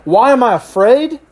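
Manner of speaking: high, incredulous retort; snapping back. Acted. Disciples answering Jesus after the storm, incredulous snap back. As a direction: throw the question back in disbelief. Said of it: "expecting them at that moment to snap back".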